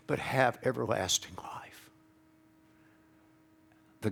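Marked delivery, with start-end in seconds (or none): 0.1-1.9 whispered. Acted. Quoting scripture.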